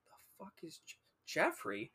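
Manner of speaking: hushed, incredulous whisper; baffled whisper. Retold or imitated. Student hearing friend's voice at door, baffled whisper. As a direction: whisper it, stumbling on the name.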